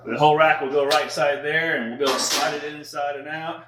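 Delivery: ordinary talk, nothing marked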